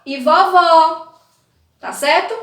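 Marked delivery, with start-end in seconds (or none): 0.1-1.1 slow, louder vovó with an open ó. Shown as a demonstration. Teacher showing children the difference: open ó. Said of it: "Olha só a diferença".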